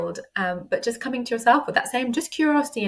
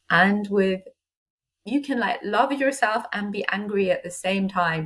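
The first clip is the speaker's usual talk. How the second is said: ordinary talk, nothing marked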